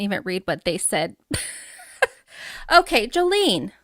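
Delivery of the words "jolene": Jolene said as "joh-LEEN"; corrected pronunciation. Real, not acted. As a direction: end Jolene with a long ee. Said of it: "like the [name] song".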